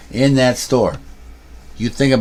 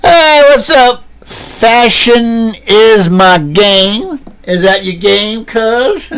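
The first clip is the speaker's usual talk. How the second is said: very high, slow, loud showman voice; put-on hype persona; 9 semitones higher, 5 dB louder, much slower than usual. Vlogger greeting viewers as a swaggering fashion guy, high slow showy voice.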